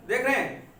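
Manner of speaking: ordinary talk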